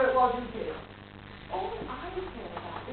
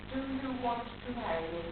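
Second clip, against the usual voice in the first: much softer, lower and measured; quiet repeated question; 4 semitones lower, 11 dB quieter than usual. Acted. Woman asking her fiancé again after his "What?", quiet and grave.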